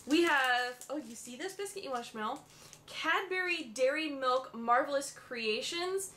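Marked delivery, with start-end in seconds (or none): 0.9-2.4 soft aside to dog. Real, not acted. Offering dog a sniff.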